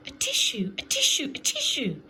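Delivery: loud, high sneezes shaped as a tissue; three sneezes. A narrator's reading. Mister Sneeze sneezes three times after the magic, loud sneezes. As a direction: sneeze each word out hard. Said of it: "sneezed Mister Sneeze".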